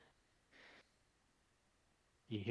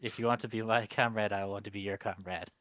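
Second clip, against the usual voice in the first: normal talking voice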